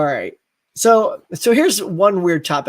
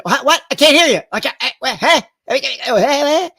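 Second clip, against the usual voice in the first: loud, high, sputtering interruptions; can't hear you; 8 semitones higher, 5 dB louder than usual. Acted. Actor unable to hear whispering co-star, loud sputtering. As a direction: shout back as if straining to hear.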